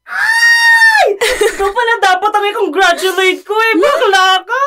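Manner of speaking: high squealing shout through a laugh; excited squeal. Acted. Friend squealing over wedding plans, delighted shout. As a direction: shriek with delight at the news.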